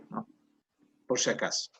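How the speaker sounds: ordinary delivery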